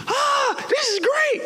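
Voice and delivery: very high, sighing falsetto relief; high-pitched, put-on bliss; mock delight. Acting the crowd relieved by water.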